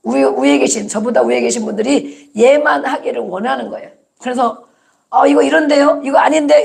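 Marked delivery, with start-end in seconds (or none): 5.1-6.6 higher, louder questioning protest; retold objection. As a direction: imitate talking back to an elder. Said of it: "이렇게 하면 막 혼내키고". (윗사람에게 따지듯 높고 크게)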